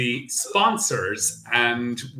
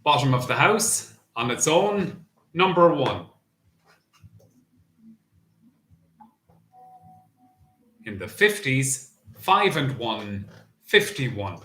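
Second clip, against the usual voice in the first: bingo caller. Acted. Bingo number calling.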